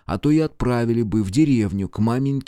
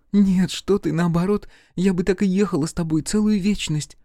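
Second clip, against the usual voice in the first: high, fervent heroine's voice; enamoured young heroine; 9 semitones higher than usual. A narrator's reading. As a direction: protest in a much higher voice, full of love. Narrator voices the heroine in love. (высокий голос влюблённой героини)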